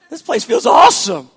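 loud, high exultant cry; a burst of happy shouting; joyful shout. Retold or imitated. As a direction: shout it out like everyone's thrilled. Passengers' joy on the plane.

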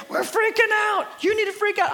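high-pitched mock panic; hammed-up hysterical cry; freaking out. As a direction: imitate someone frantic, urging others to panic. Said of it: "all the people that are freaking out".

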